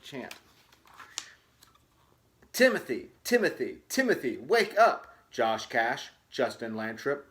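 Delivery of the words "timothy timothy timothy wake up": louder and higher, calling to wake him. Acted. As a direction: call him awake from across the room.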